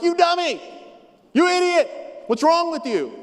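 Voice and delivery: loud, high furious yelling; angry yell. Shown as a demonstration. Pastor demonstrating tone to the congregation, angry yell. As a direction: bark it at them like you mean it. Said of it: "You don't know if I'm like".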